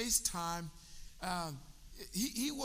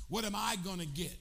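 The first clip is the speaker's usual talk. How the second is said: ordinary delivery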